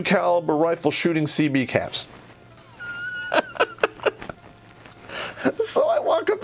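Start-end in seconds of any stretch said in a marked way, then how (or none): 2.8-4.3 high-pitched squealing laugh; real laugh breaking out at the story's punchline. Real, not acted. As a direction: burst into a high laugh at the memory. Laughing at the absurd memory.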